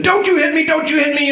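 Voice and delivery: a mock furious high scold; angriest tone. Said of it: "in the angriest, slightly out of control".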